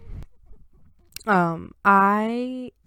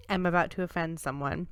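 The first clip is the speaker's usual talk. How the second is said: ordinary talk, nothing marked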